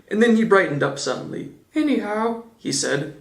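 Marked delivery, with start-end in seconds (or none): 1.7-2.4 a high, light little bear voice; Pooh voice. A narrator's reading. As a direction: pitch it up as a simple bear. Pooh's voice.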